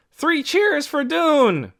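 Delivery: high, loud, slow crowd shout; cry out. A narrator's reading. Someone in the crowd shouting cheers for Doone. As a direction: call it out to the whole ballroom. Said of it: "Someone behind him cried out".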